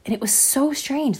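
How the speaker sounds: ordinary delivery, nothing marked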